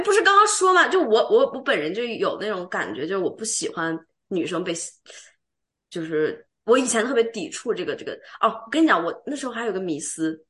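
usual speaking voice

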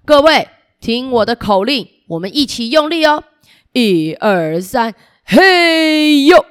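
loud counted heave; rallying shout. Acted. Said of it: "三十郎说".

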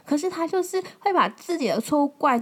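ordinary delivery

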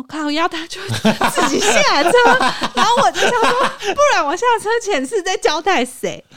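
high, loud, laughing disbelief; indignant laughing rant; laughing exasperation. Retold or imitated. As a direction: curse through laughter, outraged but amused. Exasperated curse at boyfriend.

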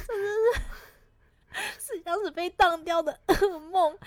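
mock sob. Mock crying about failing.

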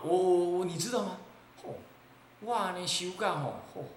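ordinary talk, nothing marked

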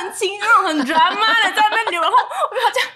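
high, loud laughing mockery of her own tears; giggly mock-melodrama. Real, not acted. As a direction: ham up the drama while laughing. Acting out dramatic tears.

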